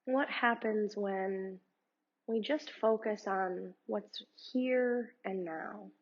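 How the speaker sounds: calm, unhurried meditation guide voice; guided meditation. Acted. Yoga teacher guiding her students through meditation, calm and unhurried. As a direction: lead the listener gently through breathing.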